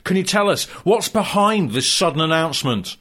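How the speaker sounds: bright, loud TV interviewer voice; hammy sports reporter. Acted. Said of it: "Alan McNish".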